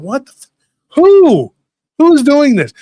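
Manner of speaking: high, loud incredulous outburst; outraged disbelief. Retold or imitated. Kid hearing about tampered Halloween candy, outraged disbelief. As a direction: blow up in shocked disbelief.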